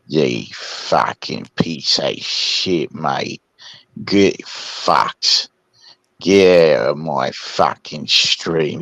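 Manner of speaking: put-on low, slow drunken Aussie cursing; drunk Captain Kangaroo. Asked by S1, drunk Aussie Captain Kangaroo, low slow slurring. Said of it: "Do a, do a drunk Captain Kangaroo".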